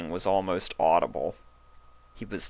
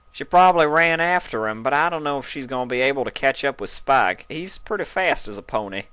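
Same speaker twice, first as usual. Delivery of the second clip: mildly stern Applejack; 7 semitones higher, 5 dB louder, faster than usual. A narrator's reading.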